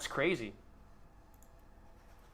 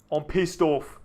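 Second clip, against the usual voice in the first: mock angry; 8 dB louder, much slower than usual.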